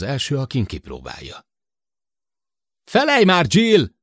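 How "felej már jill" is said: high, loud outraged yell; indignant shout. A narrator's reading. Sid yelling at Jill, indignant, through the speaker. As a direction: blast her name in outrage. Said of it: "vartyogássá torzította Sid felháborodását".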